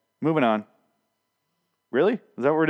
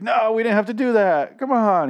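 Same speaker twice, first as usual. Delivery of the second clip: high, loud mock protest; Rick; 12 semitones higher, 5 dB louder than usual. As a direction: plead it in an exaggerated high-pitched voice. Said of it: "and Rick's like".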